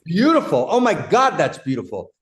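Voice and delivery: loud, high-pitched burst of amazement; bowled over with admiration; real delight. Real, not acted. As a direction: exclaim it, thrilled by the insight. Delighted by co-host's insight.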